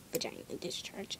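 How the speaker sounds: soft, shy whisper; embarrassed. Real, not acted. Shy girl whispering the topic to her viewers. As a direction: drop your voice on the awkward term.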